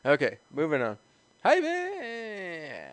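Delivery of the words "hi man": 1 put-on voice. Doing character greetings.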